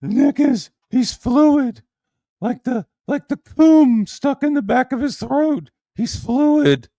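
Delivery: high, loud put-on mock voice; mocking imitation. Streamer making fun of Nick, high imitation voice. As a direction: jeer in a squealed caricature voice.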